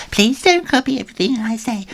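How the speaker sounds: high mimicking echo; mocking copycat. Twin copies Ginger's words, high mock echo. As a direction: parrot him back to tease.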